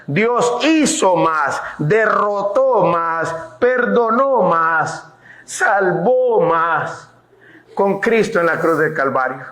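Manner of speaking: very slow, each más weighed; slow the litany down, word by word; slow emphatic build. Acted. Pastor building to the cross, very slow. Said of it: "slowed preaching emphasis".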